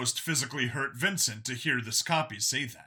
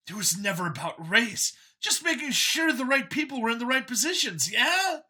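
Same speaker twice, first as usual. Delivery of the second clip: higher, louder, keen voice; a cheerful zealot pitched up; android copy, eager; 9 semitones higher, 3 dB louder than usual. A narrator's reading. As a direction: sell it like a proud believer.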